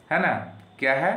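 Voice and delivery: ordinary delivery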